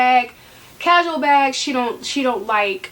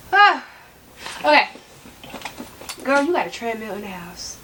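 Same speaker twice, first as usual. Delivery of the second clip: ordinary talk, nothing marked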